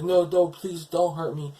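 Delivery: high, loud mock pleading; frightened plea. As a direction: act out a panicked plea for mercy. Retold memory of being attacked.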